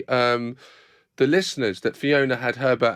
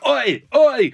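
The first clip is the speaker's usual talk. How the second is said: loud sharp oi; calling out oi; 10 semitones higher, 6 dB louder than usual. Retold or imitated. Man behind the glass shouts to warn her. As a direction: shout to grab attention. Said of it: "he was going".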